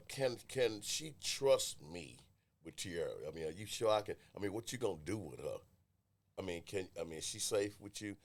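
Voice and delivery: imitating a distrustful grandmother, low and soft; quiet, halting mock interrogation; wary questioning. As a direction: act out hushed suspicion.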